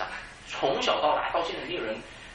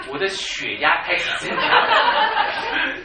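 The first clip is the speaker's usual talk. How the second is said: laughing; 3 semitones higher, 9 dB louder, much slower than usual. Real, not acted. Pastor on son failing exam.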